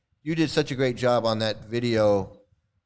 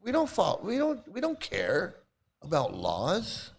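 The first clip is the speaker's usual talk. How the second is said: mock smug swami, higher; 8 semitones higher, 3 dB quieter than usual. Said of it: "their mentality is".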